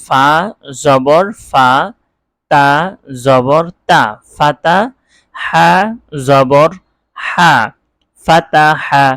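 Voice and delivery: measured letter-by-letter Arabic drill; teacher modelling syllable-by-syllable reading; spelling-out recitation. Shown as a demonstration. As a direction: spell it aloud, pausing after each piece. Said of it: "এভাবে পড়তে হবে".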